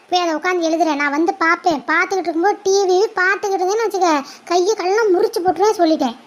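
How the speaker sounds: ordinary talk, nothing marked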